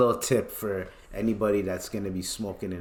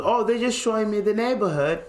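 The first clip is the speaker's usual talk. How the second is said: high, put-on British voice; British accent; 10 semitones higher than usual. Friend giving police his excuse, mock British accent. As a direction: play an innocent British visitor, pitched high. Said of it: "he kept talking in a British accent".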